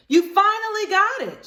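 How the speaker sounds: high, loud cheerful voice; pleased friend voice. Acted. Ruthie Mae answering Libby's apology, high and loud. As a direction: tell her she finally got it.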